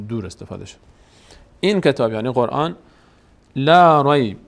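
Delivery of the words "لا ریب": loud, drawn-out recited phrase; Quranic recitation. Shown as a demonstration. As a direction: recite it loud, stretching the vowel. Reciting the verse word by word. (تلاوت بلند و کشیده)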